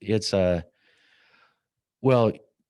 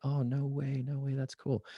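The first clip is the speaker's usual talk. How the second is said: higher, breezy "no way" from the wife; lightly voiced wife, delighted; excited wife; 8 semitones higher, 6 dB quieter than usual. Wife reacts on speakerphone.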